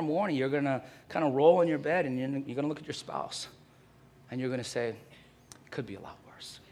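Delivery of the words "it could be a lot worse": soft, slow murmured aside; deadpan whisper. Pretend. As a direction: whisper it dryly to the spouse. Husband murmuring at spouse in bed.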